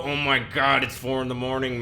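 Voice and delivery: sudden loud exasperated burst; exasperated outburst. Acted. Complaining about the roommate in a diary, exasperated outburst. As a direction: blurt it out, fed up with the roommate.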